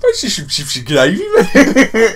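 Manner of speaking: loud, laughing mock Brummie accent; hammed-up Brummie, breaking into laughter; mock Midlands accent. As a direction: put on a daft Midlands accent. Mock accent given to Mayans, Aztecs.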